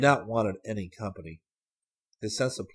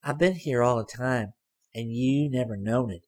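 put-on higher, louder girl's voice teasing; sly girl; 4 semitones higher, 5 dB louder than usual. A narrator's reading. Girl surprising Hayes from the back seat, teasing. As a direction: pipe up high and pleased with herself.

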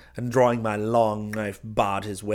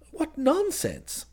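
very high, drawn-out scoff; dismissive cousin; slower than usual. Acted. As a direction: brush it off breezily, slowly, pitched up.

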